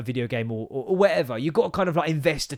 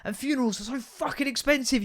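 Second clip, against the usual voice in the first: ordinary delivery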